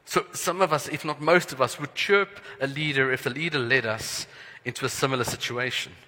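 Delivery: ordinary delivery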